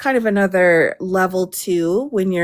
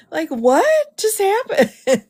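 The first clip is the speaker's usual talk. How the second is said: high, slow, stunned; mock bewilderment; 12 semitones higher, slower than usual. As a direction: play it utterly baffled. Incredulous joke, high far.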